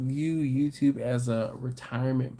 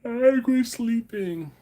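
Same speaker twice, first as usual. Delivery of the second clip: mock high quote; 10 semitones higher, 3 dB louder than usual. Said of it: "And she said".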